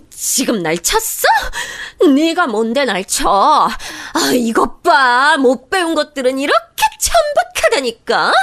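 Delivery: sister-in-law shrieking after being slapped; outraged tearful snarl. Acted. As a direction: spit out disbelief through tears. Slapped woman outraged and crying.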